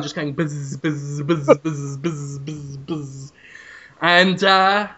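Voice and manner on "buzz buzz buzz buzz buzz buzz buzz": low, soft repeated buzz; imitated buzzing. Host imitates a skull's jaw going up and down, low buzzing. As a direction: drone like flies from a skull's moving jaw. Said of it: "jaw is still going up and down".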